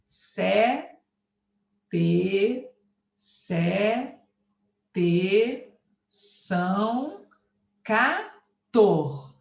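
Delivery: syllable by syllable, a clear pause after each; contagem silábica. Shown as a demonstration. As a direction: count out each syllable as a separate beat. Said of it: "Então, se você contar". (escandindo sílaba por sílaba, com pausas)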